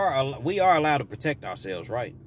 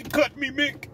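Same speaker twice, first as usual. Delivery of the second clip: high-pitched mock boxer plea; hammed-up begging in a put-on boxer voice; Rocky; 12 semitones higher, slower than usual. Boxer begging his trainer, hammed-up plea. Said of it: "And Rocky".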